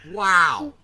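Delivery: ordinary talk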